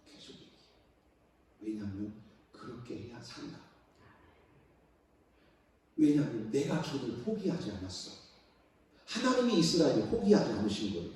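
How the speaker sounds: ordinary talk, nothing marked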